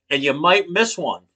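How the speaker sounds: normal talking voice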